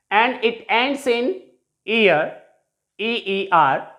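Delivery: ordinary talk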